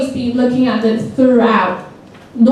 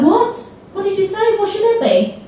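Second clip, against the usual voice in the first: much higher, incredulous questioning; mock surprised interruption; 8 semitones higher than usual. Teacher acting out interrupting a student, high and incredulous. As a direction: pounce on the mistake in mock disbelief. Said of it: "and then you're like".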